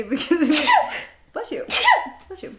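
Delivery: ordinary talk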